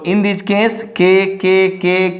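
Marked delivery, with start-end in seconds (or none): none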